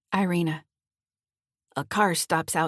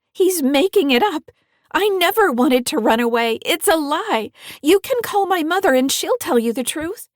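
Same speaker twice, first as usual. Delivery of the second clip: put-on high, loud pleading teen; desperate high protest; 9 semitones higher, 7 dB louder than usual. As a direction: plead frantically, close to shouting.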